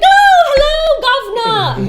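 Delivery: slow, high, loud mock British accent; hammed-up British "Oh, hello, governor". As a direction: drawl it out like a posh Brit greeting. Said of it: "her accent, I'm gonna imitate it".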